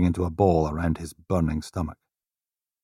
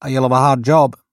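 polite desk sergeant, a bit higher; lightly voiced desk sergeant; 7 semitones higher, 7 dB louder than usual. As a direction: greet him with polite formality.

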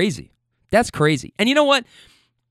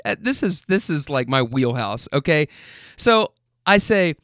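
ordinary delivery

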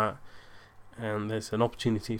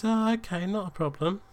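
much higher, put-on woman's voice; imitating the date; 9 semitones higher than usual. Date accepting the evening's early end, imitated high voice. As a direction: lightly mimic her agreeing, pitched up. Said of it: "she looks at me and she goes".

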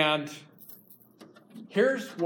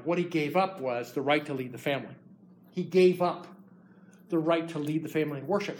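ordinary delivery

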